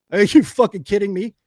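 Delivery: loud, high disbelieving outburst; can't believe he'd dare threaten you; incredulous scoff. Real, not acted.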